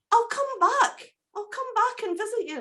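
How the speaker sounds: high, chirpy little bird voice, grateful; a small rescued bird thanking its saviour; wee cuckoo voice. Acted. Cuckoo thanks Jack for saving its life, high grateful bird voice.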